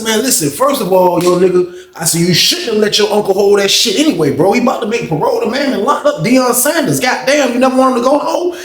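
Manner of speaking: re-enacted argument, rising in pitch; retold scolding. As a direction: chew him out, pitch climbing as it goes. Retold confrontation with the nephew.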